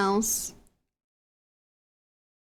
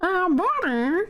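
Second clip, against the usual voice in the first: Monokuma voice; 6 semitones higher, slower than usual. Shown as a demonstration. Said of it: "I can do Monokuma".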